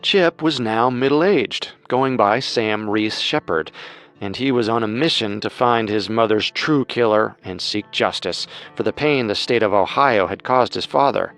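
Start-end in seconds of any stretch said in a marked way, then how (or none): none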